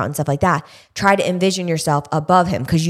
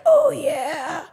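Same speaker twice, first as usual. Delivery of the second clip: high, drawn-out horse character; put-on high horse talking; imagined horse voice; 10 semitones higher, 3 dB quieter than usual. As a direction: voice a horse enjoying a long stretch. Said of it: "I envision him like".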